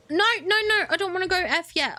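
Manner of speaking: high, hurried protest; flustered, high-pitched refusal; getting scared. Real, not acted. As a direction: blurt it in mild panic. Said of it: "I'm getting scared".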